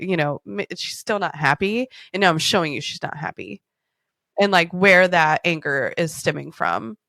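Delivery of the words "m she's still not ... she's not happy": normal talking voice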